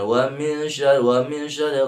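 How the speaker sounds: min run straight into syarri, unheld; rushed wa min syarri. Shown as a demonstration. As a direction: hurry through min without holding the hum. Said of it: "Jangan dilangsungkan". (wa min syarri dilangsungkan, tergesa-gesa)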